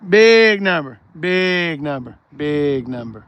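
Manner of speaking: repeat, stepping down in pitch and volume; drawn-out repeats dying away; fading echo. Pretend.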